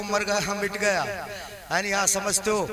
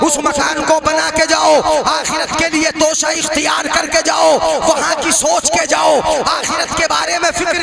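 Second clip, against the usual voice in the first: full-force preaching; 4 semitones higher, 7 dB louder than usual. Acted. Preacher exhorting the congregation, full-force sermon chant. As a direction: preach it with mounting fervour.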